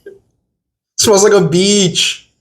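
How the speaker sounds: high, slow, drawn-out disgusted whine; dramatic disgust. Real, not acted. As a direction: overplay how gross it smells. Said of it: "I'm being dramatic".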